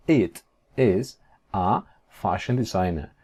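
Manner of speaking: slow, gaps between words; careful word-by-word English; slow model sentence. Shown as a demonstration. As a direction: give each word its own beat. Teacher models English sentence slowly.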